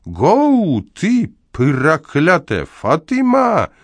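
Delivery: very high, drawn-out holler; cursing yell. A narrator's reading. Neighbour urging on horse and Fatyma, yelling. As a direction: howl the command and curse, stretching it.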